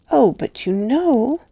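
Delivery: put-on high, slow little-girl voice; a child answering sweetly and sure; high childish reply, drawn out; child's calm answer. A narrator's reading. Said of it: "she quite adequately answered".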